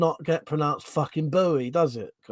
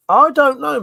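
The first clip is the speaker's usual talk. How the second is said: much higher and louder; exasperated yell; 12 semitones higher, 10 dB louder than usual. Real, not acted. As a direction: throw it out loud, fed up. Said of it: "exasperated outburst".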